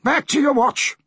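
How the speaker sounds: high-pitched command; captain's order. Acted. Captain sends sailor back on duty, high command.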